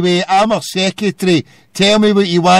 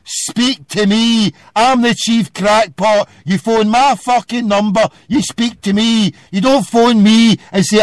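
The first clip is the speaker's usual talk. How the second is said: normal talking voice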